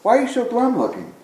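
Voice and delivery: puzzled friend. Retold or imitated. Friend puzzled by the man's gloom, mildly baffled. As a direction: ask it like a friend who can't make sense of it. Confused friend questions the man.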